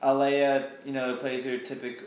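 ordinary talk, nothing marked

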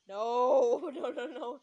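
loud drawn-out first no; alarmed protest. Acted. Ruth smoothing over an insult, alarmed protest. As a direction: cry out, then rattle off the noes.

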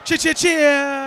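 drawn-out high, loud cheer; hype shout. Pretend. Co-host hyping the podcast episode, shouted. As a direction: shout it high and stretch each word.

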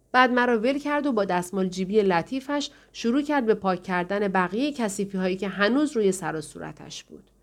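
ordinary delivery

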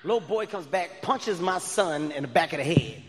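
ordinary talk

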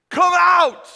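loud, high call; commanding shout. Acted. Jesus calling Lazarus from the tomb, in a loud shout. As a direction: call it with full force. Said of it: "he hears this".

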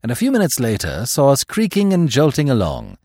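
ordinary delivery

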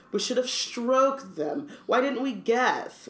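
slow, high, sarcastic sneer. A narrator's reading. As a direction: drag it out in high-pitched mockery. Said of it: "Malfoy sneered".

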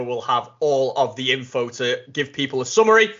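ordinary delivery

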